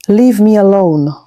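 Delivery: disgusted. A narrator's reading. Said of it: "Milka recoiled, her face twisting in disgust".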